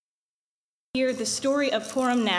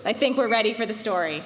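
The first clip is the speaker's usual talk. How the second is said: ordinary delivery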